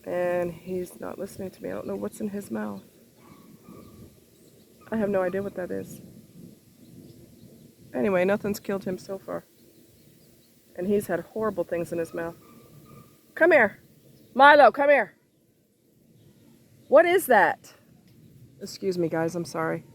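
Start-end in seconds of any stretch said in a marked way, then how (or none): 0.0-12.3 softer muttered aside; softly, half to yourself; quiet aside. Real, not acted. Muttering aside about dog. 13.3-17.7 loud call to the dog; sharp loud call. Real, not acted. Calling dog again.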